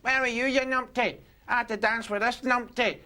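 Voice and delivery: high, scolding nag; scolding girlfriend. Retold or imitated. Girlfriend scolds the skinhead boyfriend, high and nagging. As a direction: give the boyfriend a piece of her mind. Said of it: "she starts having a go at him".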